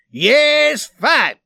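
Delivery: high, loud roar of assent. A narrator's reading. Swede accepting the challenge, furious roar. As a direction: roar back, accepting the fight. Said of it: "roared the Swede".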